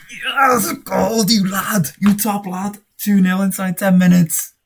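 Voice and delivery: loud, high-pitched ecstatic shout; real jubilant outburst, far above normal voice; goal celebration. Real, not acted. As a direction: yell it out as your team scores.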